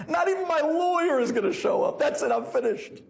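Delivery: high, acted outburst; hammed-up hopeless client; exasperated despair. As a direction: throw up the pitch in mock despair. Client despairs as Rebbe laughs.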